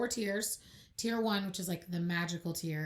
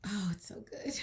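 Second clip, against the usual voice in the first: whispering; 6 dB quieter than usual. Real, not acted. Gushing aside about the movie.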